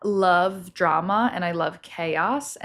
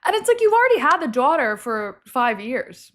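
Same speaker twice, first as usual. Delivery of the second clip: Jersey accent; 7 semitones higher, 5 dB louder than usual. Real, not acted. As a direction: let the Jersey accent slip out. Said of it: "My Jersey accent just came out".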